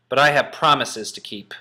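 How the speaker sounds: determined. Shown as a demonstration. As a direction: declare it with resolve. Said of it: "read it trying to express the adjective".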